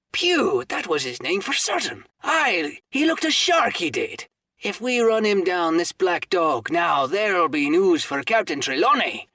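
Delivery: high, loud and bustling; a big show of excitement; excited pirate. Acted. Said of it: "now quite excited".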